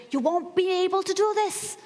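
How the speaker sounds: mock inner bully yelling doubt; bodybuilder voice in your head. The inner critic voice in her head, mock bodybuilder scream. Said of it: "the voice in your head is suddenly super strong".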